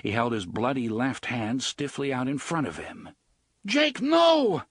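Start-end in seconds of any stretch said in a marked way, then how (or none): 3.6-4.6 high, loud desperate cry; desperate shout. Acted. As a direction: scream it to stop him. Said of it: "Eddie shouted desperately".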